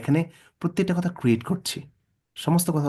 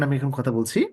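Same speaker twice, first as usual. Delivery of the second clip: normal talking voice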